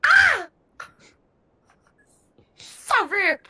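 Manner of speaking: high, loud Irish gnome yelp of offence; shocked outburst. Acted. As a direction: squeal it out, scandalised, in the Irish gnome voice. Gnome reacts to kissy gestures.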